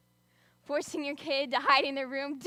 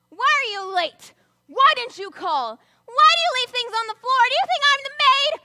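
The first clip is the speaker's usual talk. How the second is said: acted angry yelling; 10 semitones higher, 16 dB louder than usual. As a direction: yell the complaints like a fed-up parent. Imitating parents yelling.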